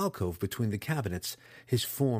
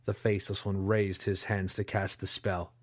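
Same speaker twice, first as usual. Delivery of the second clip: ordinary delivery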